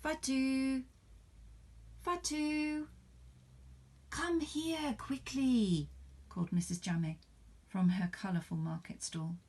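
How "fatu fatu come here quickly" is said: high, slow drawn-out call. Acted. Missus Jame calls Fatu from her market stall, slow high call. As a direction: beckon her over to the stall.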